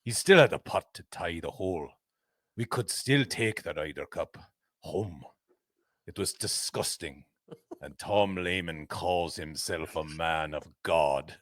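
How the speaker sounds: low, slow mock Scottish golfer; Scottish accent. Reading the Sam Torrance quote in a low, slow Scottish accent. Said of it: "any issues with that accent".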